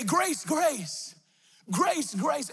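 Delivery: re-enacted call of faith, pitched high. Driver calling grace toward the mountain, high re-enacted call.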